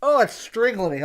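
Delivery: coughing through a tight mask, voice pinched; real coughs, words squeezed out between them; choking in mask. Real, not acted. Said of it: "it feels like I'm being strangled".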